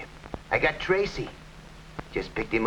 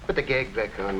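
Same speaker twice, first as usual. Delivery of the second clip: usual speaking voice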